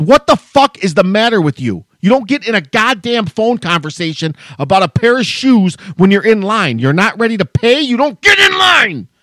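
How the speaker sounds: loud, rising to a high shouted yell; imagined outburst at a customer blocking the line; imagined furious rant. Retold or imitated. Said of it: "I would have thrown that shit on the ground and said".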